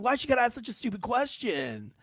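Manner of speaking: high-pitched exasperation; indignant retort. Retold or imitated. Husband's indignant retort, retold.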